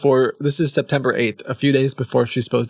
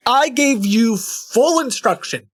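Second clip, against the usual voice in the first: exasperated; 10 semitones higher, slower than usual. Pretend. Reading scammer's angry email.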